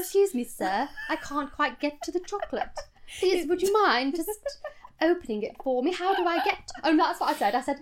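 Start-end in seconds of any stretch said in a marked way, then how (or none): 0.0-6.7 high, over-polite British plea; exaggerated British accent. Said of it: "in an exaggerated British accent".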